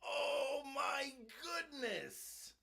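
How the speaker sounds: high, slow, drawn-out astonishment; awestruck, pitched well up; real amazement. Real, not acted. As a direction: stretch it out high in disbelief. Stunned by the gift.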